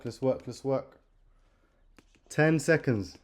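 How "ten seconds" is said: countdown getting louder and higher; countdown shout. Real, not acted. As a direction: yell each number, building to the end. Countdown to end of round.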